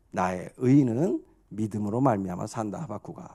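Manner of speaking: low, soft divine pronouncement; acted God answering calmly and gravely; God's voice. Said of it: "그럴 때 하나님께서 하시는 말씀이에요".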